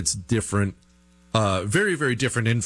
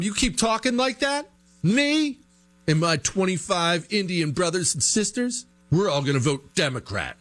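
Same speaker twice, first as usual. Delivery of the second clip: mock threat; 7 semitones higher than usual. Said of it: "that whole threatening thing".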